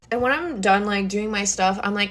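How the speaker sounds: usual speaking voice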